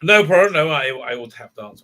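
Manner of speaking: ordinary talk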